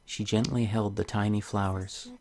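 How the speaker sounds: soft, gentle storybook reading; story narration. Acted. Reading a tale to viewers, soft storybook voice. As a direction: read the fairy tale softly to the viewers. Reads the game's story aloud.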